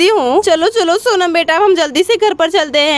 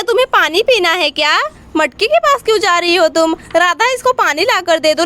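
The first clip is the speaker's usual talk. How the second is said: ordinary talk, nothing marked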